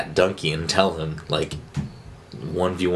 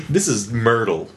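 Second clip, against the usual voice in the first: usual speaking voice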